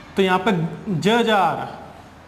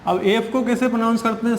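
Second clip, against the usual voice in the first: usual speaking voice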